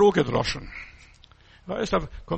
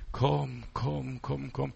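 soft beckoning voice; 3 semitones lower, 5 dB quieter, much slower than usual. Retold or imitated. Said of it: "da ist eine Stimme".

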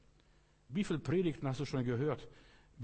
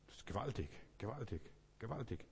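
much softer, marvelling to himself; hushed awe; 10 dB quieter than usual. Real, not acted. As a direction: drop the volume in wonder. Preacher marvels at the verse. (leise staunend)

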